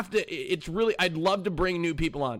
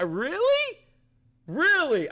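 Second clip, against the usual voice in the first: incredulous; 12 semitones higher, slower than usual. Real, not acted. Disbelief at chat's reaction.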